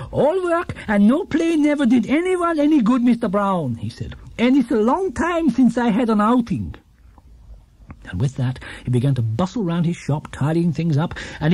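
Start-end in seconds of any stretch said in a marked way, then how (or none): 0.1-3.8 put-on higher, eager elderly shopkeeper; enthusiastic old man. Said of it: "became more and more enthusiastic". 4.4-6.8 put-on higher, eager elderly shopkeeper; enthusiastic old man. Gruber continues.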